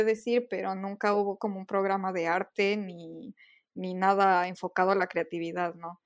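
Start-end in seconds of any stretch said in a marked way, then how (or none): none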